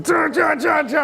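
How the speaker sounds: high shouted dun dun chopping sounds; chopping noise. Retold or imitated. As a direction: bang out the hacking noise like a kid with a knife. Kid hacking at coffee table.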